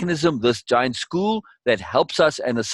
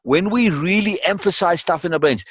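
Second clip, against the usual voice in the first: ordinary delivery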